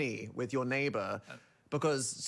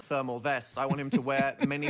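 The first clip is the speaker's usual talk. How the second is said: normal talking voice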